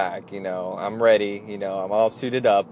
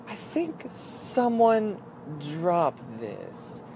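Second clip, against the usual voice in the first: slow, softer, drawn-out hinting; weird, suggestive; 5 semitones higher, 7 dB quieter, much slower than usual. Retold or imitated. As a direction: say it slowly and softly, loaded with hidden meaning. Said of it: "she kinda said in a weird way".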